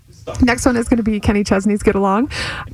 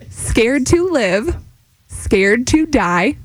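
slower; slower than usual. Shown as a demonstration. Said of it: "All right, slower".

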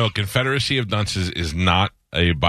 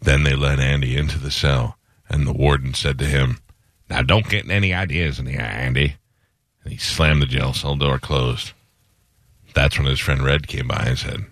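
audiobook narration; 5 semitones lower than usual. Pretend. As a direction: read it like a gravely serious narrator. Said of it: "do a little bit of Shawshank Redemption".

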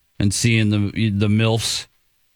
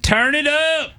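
hype voice; 12 semitones higher, slower than usual. Retold or imitated. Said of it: "they said".

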